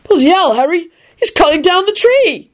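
high-pitched frightened yelp; panic-stricken screech. A narrator's reading. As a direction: screech in alarm, voice way up. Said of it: "Ron screeched in a panic-stricken voice".

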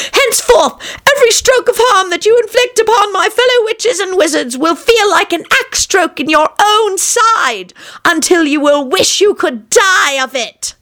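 high, ominous witch voice; cursing witch stump. A narrator's reading. Witch stump to the petrified king, high cursing voice. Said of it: "it told the petrified king".

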